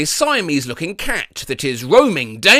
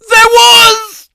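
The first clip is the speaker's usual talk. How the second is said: higher, louder mock cry; excited shout; 9 dB louder than usual. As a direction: burst in with glee. Gleeful interjection.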